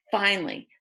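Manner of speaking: ordinary delivery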